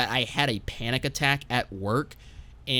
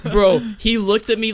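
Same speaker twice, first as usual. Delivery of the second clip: laughing; 8 semitones higher, 7 dB louder than usual. Real, not acted. Laughing at his own story.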